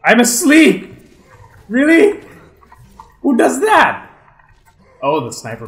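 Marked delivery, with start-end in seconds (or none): 0.0-4.1 loud, high exasperated yell; frustrated shout. Real, not acted. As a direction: yell it in disbelief. Frustrated shout at being slept.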